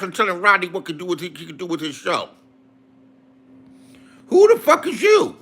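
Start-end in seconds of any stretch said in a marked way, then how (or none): 4.3-5.4 high-pitched, indignant outburst; angry challenge. Real, not acted. Ranting at a chat critic, angry challenge. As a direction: fire the question out, high and outraged.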